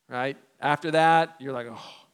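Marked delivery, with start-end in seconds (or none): 1.7-2.1 very quiet exhaled "oh"; soft acted sigh of embarrassment; facepalm groan. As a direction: let out a hushed, mortified breath. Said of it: "He does his own face palm".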